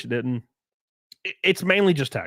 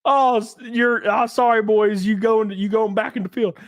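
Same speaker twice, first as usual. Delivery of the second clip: higher mocking voice, a hint of a caricature; mocking impression; 6 semitones higher than usual. Streamer parodies a TV host's claim in a higher voice. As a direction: ridicule the claim by acting it out. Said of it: "Whoopi Goldberg or somebody said".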